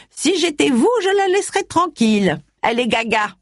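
high, sneering mimicry of a nosy neighbour; lightly hammed-up gossip voice; mocking the neighbour. Old lady imitating her neighbour.

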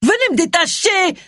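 high-pitched yell for help. A narrator's reading. Tied-up Agatha to the onlookers, high yell. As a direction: yell it out, pitch leaping right up. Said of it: "hurla Agatha".

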